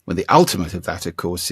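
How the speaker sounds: usual speaking voice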